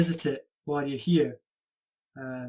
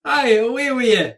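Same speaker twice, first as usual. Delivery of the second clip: Aye, away with you in broad Scottish; 5 semitones higher, 6 dB louder than usual. Shown as a demonstration. Tutor teaching the learner a Scottish phrase, broad accent. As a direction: lean into the Scottish vowels on Aye. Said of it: "teaching phrase to learner".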